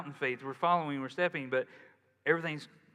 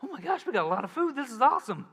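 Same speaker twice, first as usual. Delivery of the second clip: much higher, quick gleeful gush; excited about leftovers; 10 semitones higher, 5 dB louder, faster than usual. Retold or imitated. Pastor recalling finding lots of leftover food, quick high gleeful gush. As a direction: burst out like a kid spotting a feast.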